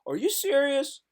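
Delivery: acting out disbelief at rude comments; mock disbelief. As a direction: go from hushed to shrill outrage. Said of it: "It's like".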